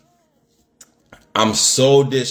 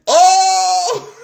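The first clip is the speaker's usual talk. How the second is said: loud high mock cheer of the choir guys; group shout; 5 dB louder than usual. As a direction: shout it out like the whole choir teasing.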